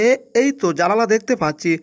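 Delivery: usual speaking voice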